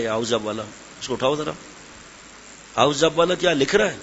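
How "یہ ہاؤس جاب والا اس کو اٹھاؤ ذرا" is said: dropped low and soft, half to himself; quiet aside. Real, not acted. Preacher singling out a house-job doctor in the crowd, quiet aside. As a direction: murmur it aside, then ask him to stand.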